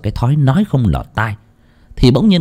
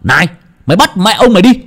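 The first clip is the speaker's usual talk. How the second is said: louder, fast defiant taunt; defiant yelling; 5 semitones higher, 3 dB louder, faster than usual. A narrator's reading. Husband taunting the fleeing spirit, defiant yell. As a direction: dare the spirit to catch you.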